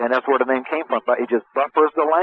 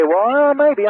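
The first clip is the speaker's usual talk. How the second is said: much higher, doubtful and wavering; lightly voiced unsure buyer; hesitant prospect; 12 semitones higher, 4 dB louder, slower than usual. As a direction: prospect hedging about seeing the pitch. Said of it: "if they say".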